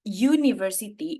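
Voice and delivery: UNIVERSITY pronounced syllable by syllable, stretched out with an initial "yu"; cara baca university. Shown as a demonstration. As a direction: spell out the sounds of "university" very slowly, starting "yu". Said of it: "itu cara bacanya itu". ("university" dieja pelan per suku kata, diawali bunyi "yu")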